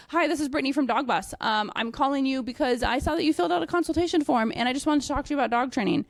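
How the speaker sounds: high, fast canned sales spiel; scripted sales pitch. Shown as a demonstration. Trainer mocking the wrong sales call, fast scripted pitch. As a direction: rattle it off like a script. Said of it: "I don't say".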